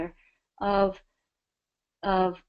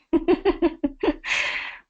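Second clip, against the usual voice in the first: laugh; 10 semitones higher than usual. Real, not acted. Laughs at zipping through traffic.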